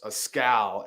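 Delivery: ordinary delivery, nothing marked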